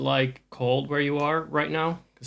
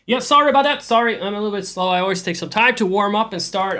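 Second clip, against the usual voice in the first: loud flustered apology; 8 semitones higher, 8 dB louder than usual. Real, not acted. Suddenly notices stream is live.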